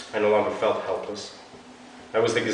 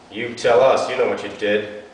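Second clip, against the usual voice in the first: stiff cop; 3 semitones higher, 7 dB louder than usual. Acted. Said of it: "lips barely moving".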